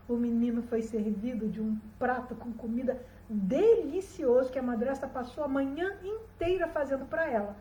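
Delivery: usual speaking voice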